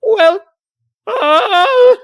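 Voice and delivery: very high, loud voice stalling mid-greeting; faltering crab mascot. Acted. Mascot about to repeat his greeting recognizes someone, high loud falter. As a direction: squeal the greeting, then falter into a long uh. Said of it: "He's like".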